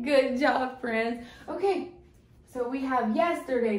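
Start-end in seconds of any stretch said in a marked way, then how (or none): none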